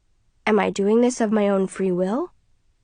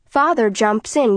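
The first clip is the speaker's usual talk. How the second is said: usual speaking voice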